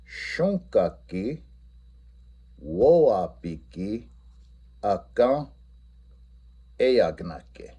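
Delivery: each Lakota word said slowly with a rise; modelling the students' Lakota reply slowly; slow model answer. Shown as a demonstration. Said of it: "what their reply would be".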